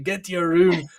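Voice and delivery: lightly imitated angry parent giving an order; mad mum. Mum sending her young son away, mock angry scolding. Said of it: "she was getting mad".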